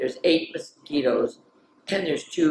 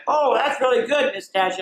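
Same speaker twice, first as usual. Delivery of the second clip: put-on kids; 9 semitones higher, 4 dB louder than usual. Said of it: "they went".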